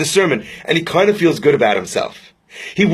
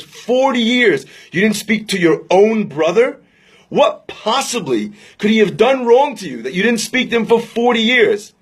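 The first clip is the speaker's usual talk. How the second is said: stretched-out, higher-pitched disbelief; incredulous; 4 semitones higher than usual. Retold or imitated. Rabbi questioning an estranged brother, incredulous. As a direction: question him in astonishment, drawing out the years.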